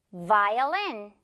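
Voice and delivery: "violin" drawn out, clear v; careful buzzing start to "violin". Shown as a demonstration. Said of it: "핸드폰 진동".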